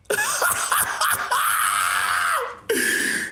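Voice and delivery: low wheezing laugh; shaking silent laughter; long breathy laugh. Real, not acted. Laughing at his own joke.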